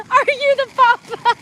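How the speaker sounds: high, laughing "are you the papa"; mock paternity question. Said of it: "and be like".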